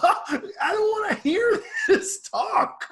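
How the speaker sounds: very high, drawn-out laughing wail; hammed-up refusal, cracking up; laughing protest. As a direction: object through laughter in a squealing voice. Laughing mock protest at the joke.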